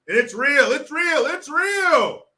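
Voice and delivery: mock pleading insistence; mock insistent speaker. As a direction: imitate an over-insistent orator, pitch rising. Mimics the speaker's repetition.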